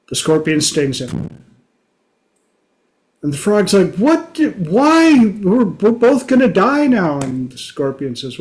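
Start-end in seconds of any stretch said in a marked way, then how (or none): 3.9-7.2 much higher, disbelieving what, why; shocked frog. Retold or imitated. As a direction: cry out in stung disbelief. Frog stung midway across.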